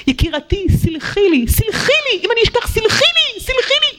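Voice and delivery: high, sing-song begging; pleading apology. Acted. Borrower begging her friend to forgive her, high and pleading. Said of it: "להגיד".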